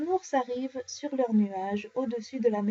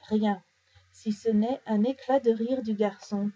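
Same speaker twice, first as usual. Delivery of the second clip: ordinary delivery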